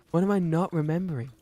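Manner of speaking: high and loud, puzzled; mock frustration. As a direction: demand it again. Repeating the joke.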